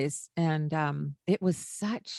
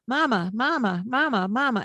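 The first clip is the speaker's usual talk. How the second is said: a light imitation of a child nagging "mama", higher; kids interrupting; 5 semitones higher, 4 dB louder than usual. Child interrupts mother's conversation, lightly imitated nagging. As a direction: lightly mimic a child tugging for attention. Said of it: "the kids are going".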